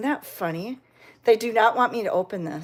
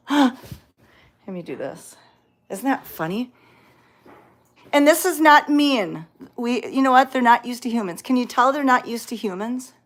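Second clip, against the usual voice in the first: normal talking voice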